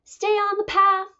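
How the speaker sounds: high, loud mother's call; calling after her. A narrator's reading. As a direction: call out a warning down the path. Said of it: "her mother called after her".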